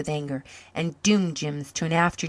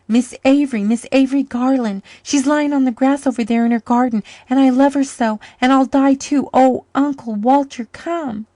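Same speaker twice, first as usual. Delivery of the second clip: put-on high, loud, tearful child's plea; desperate pleading; 8 semitones higher, 8 dB louder than usual. Boy begging his uncle to save his friend, panicked. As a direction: beg as if your world is ending.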